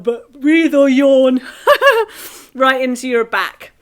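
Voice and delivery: laughing, loud and high; laughing through the words. Real, not acted.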